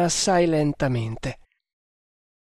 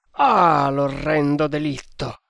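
louder, horrified cry; exclamation of outrage; 4 dB louder than usual. Acted. Gari hears the Virgin may be freed, outraged louder cry. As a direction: cry out at the awful crime. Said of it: "esclamò l'indiano".

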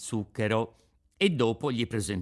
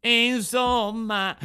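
high, loud, drawn-out exasperated cry; tearful outburst; 12 semitones higher, 7 dB louder, much slower than usual. A narrator's reading. Puppet bursting into tears at the Fairy, exasperated cry. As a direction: burst out in a child's tantrum. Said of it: "gridò Pinocchio dando in uno scoppio di pianto".

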